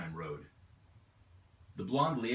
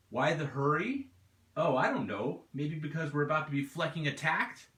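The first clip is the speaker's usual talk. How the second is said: sarcastic; 6 semitones higher, 4 dB louder than usual. Acted. Driver sarcastically throws question back.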